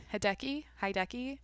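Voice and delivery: tentative, questioning try at the name Hideki; sounding out. Real, not acted. Podcaster unsure of a golfer's first name, sounding it out. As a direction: try "Hideki" two ways, softly, each rising like a question. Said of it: "I'm just trying to sound it out".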